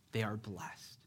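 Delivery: soft whispered repeat, letting it sink in; a hushed, reverent whisper; whispered echo. Shown as a demonstration. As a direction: whisper it back to the room. Repeating God's words for emphasis.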